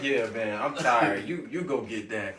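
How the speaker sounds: low, soft lazy king brushing it off; a drowsy ruler waving someone off. Retold or imitated. Pharaoh lazily sending someone else to fetch it, low and soft.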